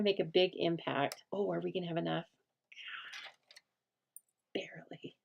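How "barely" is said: whisper. Real, not acted. Worried about running out of paper.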